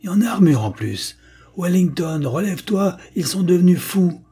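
high, loud frantic bawl; bawling alarm. A narrator's reading. Carruthers yells to Wellington through the megaphone, high and loud. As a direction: yell it in alarm to your men.

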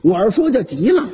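usual speaking voice

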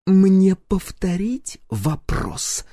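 slow, menacing hiss; wife hissing at the captive; angry hiss. Acted. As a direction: hiss the threat into his face. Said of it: "зло прошипела ему в лицо Гюрза".

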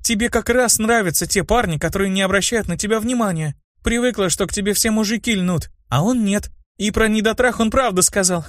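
put-on high, girlish needling; teasing girl. A narrator's reading. Girl taunting her friend about boys, high and teasing. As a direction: tease in a high girl's voice.

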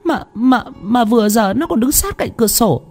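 high, trembling, stammering plea; frightened pleading. A narrator's reading. Wife insisting she saw mother's ghost, high frightened pleading. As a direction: plead desperately to be believed. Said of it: "Thanh phân trần".

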